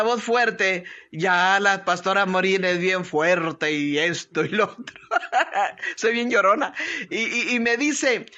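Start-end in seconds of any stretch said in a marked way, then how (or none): 5.1-5.9 a quick self-mocking laugh; short laugh. Real, not acted. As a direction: laugh at yourself. Laughs at own reputation. (risa breve burlándose de sí misma)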